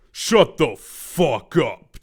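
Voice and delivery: loud, slow, a different voice; angry retort. Acted. Terezi shutting Will up before running after Dave, angry and loud. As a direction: spit it out, drawn out and loud.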